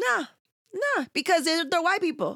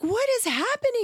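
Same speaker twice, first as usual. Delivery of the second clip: put-on high, alarmed kid voice; frightened child in traffic; son's voice; 11 semitones higher than usual. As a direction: ask it like the scared little boy. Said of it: "he's like".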